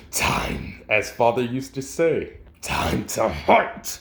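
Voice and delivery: drawn-out, half-breathed menace; slow menacing monster. Acted. Werewolf growling to the hoodie person, slow and menacing. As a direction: let the beast savor each word. Werewolf speaks before cutting off.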